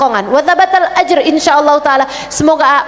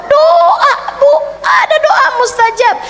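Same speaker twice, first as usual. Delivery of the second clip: laughing shout; 10 semitones higher than usual. Real, not acted.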